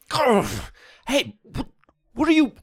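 high, loud brutish monster voice; a greedy brute snarling threats; barbarian. Acted. GM voices monster demanding the rock.

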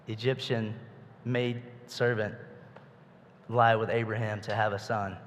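ordinary talk

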